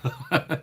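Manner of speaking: short laugh, high and loud; real laugh; high burst of laughter. Real, not acted.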